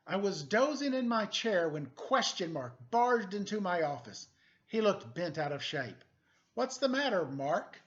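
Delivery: animated picture book reading; story narration. A narrator's reading. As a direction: read it like a noir storybook to kids. Reads picture book aloud.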